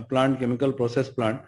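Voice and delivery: normal talking voice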